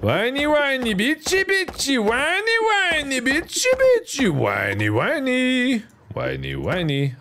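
squeaky, very high hammed-up whine; high mock whining. Player escalating the joke about complaining, high mock whining. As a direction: chant it way up high like a pretend crybaby. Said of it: "I'm whining and I'm bitching".

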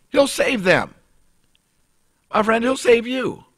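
ordinary talk

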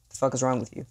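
usual speaking voice

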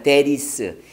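"that is" said as "tha-ris"; "that is" joined into one word with a tap; linked R sound. Shown as a demonstration. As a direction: turn the T of "that is" into a tapped R. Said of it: "R로 발음이 난다는 거예요".

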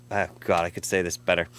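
ordinary talk

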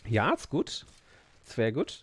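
short lilting phrases in put-on German accent; mock German accent. Streamer retrying an impression for viewers, mock German accent. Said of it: "I like to do the German accent".

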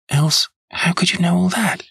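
high-pitched, astonished client; startled cry. A narrator's reading. Startled client to the detective, high astonished exclamation. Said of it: "she cried".

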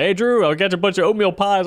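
high, eager imitation of a friend; excited friend announcing. Friend walks in with oatmeal pies, imitated excited call.

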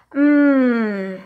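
loud, savouring hum; happy mmm of tasting. A narrator's reading. Camila tastes the lima beans, savouring hum. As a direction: relish the taste. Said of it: "said Camila".

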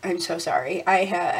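normal talking voice

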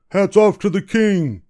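high, loud military command; captain's shout. A narrator's reading. Guard captain orders the crowd before the king, shouting. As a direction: call it out over the whole crowd. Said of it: "shouted the captain".

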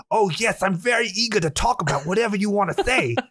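high, eager German-accented imitation; hammy German accent, earnest and eager. Imitating wife's cousin's tiny sweet friend, high eager German accent. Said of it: "the accent I'm doing is, like, exactly him".

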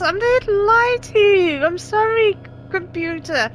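high, slow, insistent denial; high pleading protest. Real, not acted. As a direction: protest your innocence loudly. Pleading with game computer.

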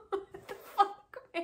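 wheezy laugh through the words; half-laughing what the fuck; laughing in disbelief. Real, not acted.

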